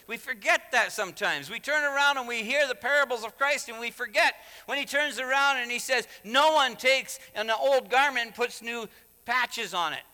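loud, high, driving sermon delivery; impassioned preaching. Real, not acted. Preacher tells of Paul's dispute with Peter, loud and impassioned. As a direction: preach it at full force.

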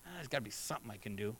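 hurried, hushed self-doubt; anxious mutter. Retold or imitated. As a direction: rush it under your breath like a worried striver. Voicing the striving believer.

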